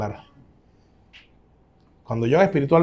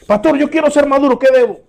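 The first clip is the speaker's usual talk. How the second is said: a higher, louder put-on voice of a churchgoer; eager believer; 11 semitones higher, 8 dB louder than usual. Churchgoer asking the pastor how to mature, higher and louder. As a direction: play the keen member asking for advice. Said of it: "quote marks: a believer asks the pastor".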